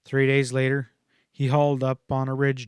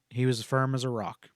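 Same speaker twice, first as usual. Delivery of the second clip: usual speaking voice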